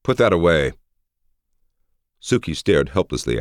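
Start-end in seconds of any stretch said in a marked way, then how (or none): 0.0-0.7 higher-pitched firm order; commanding. A narrator's reading. Ali orders his guard to sheathe the sword, commanding. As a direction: order it firmly, as a master. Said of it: "commanded Ali".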